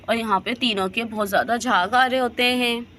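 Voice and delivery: usual speaking voice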